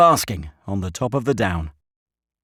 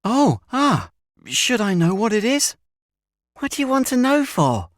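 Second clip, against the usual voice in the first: high-pitched scoffing schoolboy; scornful schoolboy; 14 semitones higher, 3 dB louder than usual. A narrator's reading. Schoolboy brushing off his friend's question, high and scornful. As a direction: answer back in a high, dismissive boy's voice. Another boy scornfully answers Stanley.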